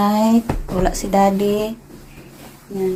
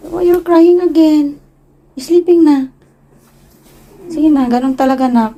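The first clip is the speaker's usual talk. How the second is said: high sing-song mother scolding; 7 semitones higher than usual. Real, not acted. Mother to her crying son, high sing-song scold. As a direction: coax him out of crying.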